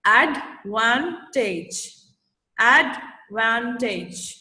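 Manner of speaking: advantage in slow, separated syllables; sound by sound. Shown as a demonstration. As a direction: sound out advantage, ad-van-tage, piece by piece. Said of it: "अ के sound ने ड को जोड़ा".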